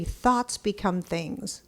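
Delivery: normal talking voice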